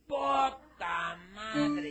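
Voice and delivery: long, stretched, loud swear; a slow, loud exclamation of dismay; drawn-out curse. Acted. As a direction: drag the curse out, alarmed. Donkey sinking in swamp.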